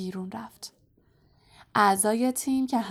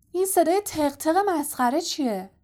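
higher-pitched, moderately raised yell; calling out; 7 semitones higher than usual. A narrator's reading. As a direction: call out an irritated question in flight. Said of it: "فرد فریاد زد". (با فریاد)

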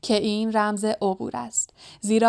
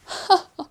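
short high-pitched snicker; mocking cackle; 9 semitones higher than usual. A narrator's reading. Mischievous spirit laughs at Harry, mocking cackle. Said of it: "هر هر خندید".

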